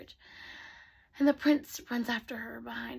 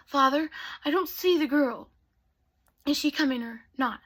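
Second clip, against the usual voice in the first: impatient prince; 3 semitones higher, 7 dB louder than usual. Acted. Prince's impatient question to father.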